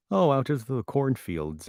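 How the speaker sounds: full Minnesota accent. Shown as a demonstration. Redoing the line with full Minnesota accent. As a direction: stretch the vowels like a Minnesotan. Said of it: "get the full accent".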